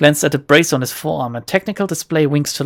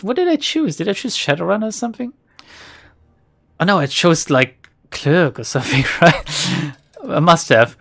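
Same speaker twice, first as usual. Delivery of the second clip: ordinary talk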